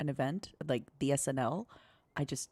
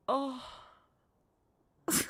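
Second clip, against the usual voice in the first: usual speaking voice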